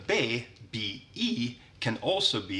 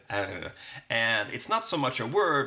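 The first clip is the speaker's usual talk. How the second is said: normal talking voice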